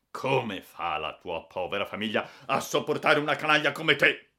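building to a loud, high yell; angry scolding. A narrator's reading. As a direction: rail at the rascal with mounting outrage. Furious owner insults the boy.

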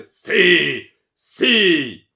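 two long, high roars; furious yell. A narrator's reading. Baron insisting he will kill her, roaring. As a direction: bellow each word with all your force.

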